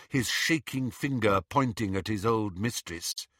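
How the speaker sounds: usual speaking voice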